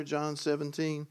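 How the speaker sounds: normal talking voice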